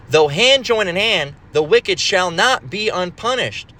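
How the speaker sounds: slow, lifted verse reading; solemn slow scripture reading. Acted. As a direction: read the verse out slowly and solemnly.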